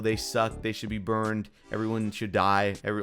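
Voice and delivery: ordinary talk, nothing marked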